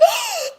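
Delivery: big shocked intake of breath; sharp gasp. Retold or imitated. Reviewing the episode, reacting to the crash with a gasp. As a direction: gasp like the car just got hit. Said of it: "And I was like".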